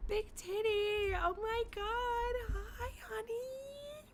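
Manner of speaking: high-pitched teary welcome; excited high squeal. Real, not acted. Greets friend on stream.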